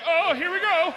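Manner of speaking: high, loud "here we go"; rising alarm. Acted. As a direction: call it out as it starts going wrong. Starts to slip.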